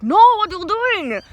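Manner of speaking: mock outburst in a put-on Indian accent; bad Indian accent. Boss stops worker over the cheese, in a hammy Indian accent. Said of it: "horrible Indian accent".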